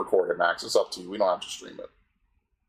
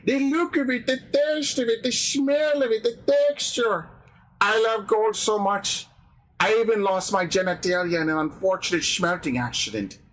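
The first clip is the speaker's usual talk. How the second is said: very high, slow, cartoonish villain voice; gold-obsessed villain, gloating; 12 semitones higher, slower than usual. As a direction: savor each word like an eccentric gold-mad villain. Plays a gold-obsessed film villain.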